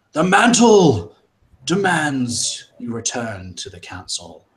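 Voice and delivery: high, loud, slow booming command; booming leader voice. Acted. Mantle calling the crowd back to the council, booming. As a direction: proclaim it to the whole crowd.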